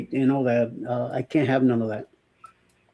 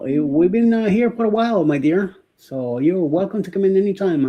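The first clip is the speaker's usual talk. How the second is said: ordinary talk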